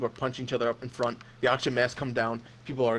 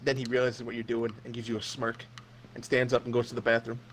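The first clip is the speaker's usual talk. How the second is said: normal talking voice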